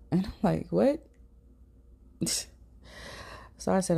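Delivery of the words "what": sharp high confused what; surprised what. Retold or imitated. Shocked at a stranger's claim, high baffled what. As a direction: snap back a baffled what.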